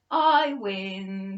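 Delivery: loud triumphant announcement; a loud, triumphant child's win; declaring. A narrator's reading. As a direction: crow it out proudly. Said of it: "she declared".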